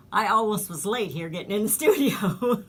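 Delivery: laughing at yourself for being late. Real, not acted. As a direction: break into a real laugh near the end. Flustered host laughs at being late.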